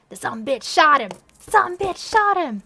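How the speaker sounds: loud, slow, high shout of grief; hammed-up grieving yell; anguished shout. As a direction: scream it out in anguish.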